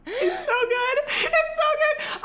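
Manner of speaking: very high, squealed delight; gushing delight. Real, not acted. Fan praising a favourite weeping scene, gushing. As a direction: gush in a high squeal, nearly laughing.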